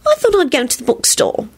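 put-on high, sugary girlfriend voice; sweet. A narrator's reading. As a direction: tell him sweetly, up high. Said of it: "she replied sweetly".

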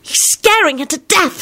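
high-pitched, vehement protest; vehement hiss. A narrator's reading. Solange objecting as she watches Lupin, vehement. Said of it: "Solange hissed vehemently".

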